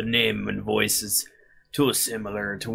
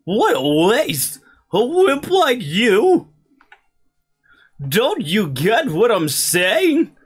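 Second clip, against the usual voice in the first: put-on rival kid voice; 12 semitones higher, 8 dB louder than usual. Voicing rival in Pokemon dialogue.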